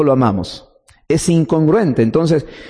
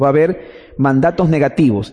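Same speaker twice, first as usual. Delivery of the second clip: normal talking voice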